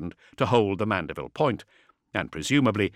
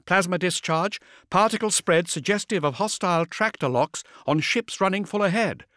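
brisk, higher female officer; vox officer voice; 10 semitones higher than usual. A narrator's reading. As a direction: report it like a busy comms officer.

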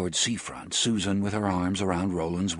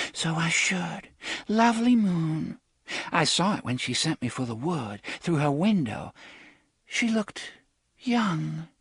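put-on childlike longing; 9 semitones higher than usual. A narrator's reading. Entranced girl, childlike greed.

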